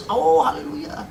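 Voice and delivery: high, elated imitated cry; happy exclamation. Wife rejoicing at the news, imitated happy shout. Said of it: "senang, senang dia".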